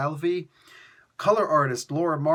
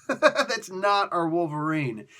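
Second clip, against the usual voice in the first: slow, amused repeat through laughter; laughing; 3 semitones higher, slower than usual. Real, not acted. Fan laughing at puppy-eyed Wolverine, laughing. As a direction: laugh your way into the words.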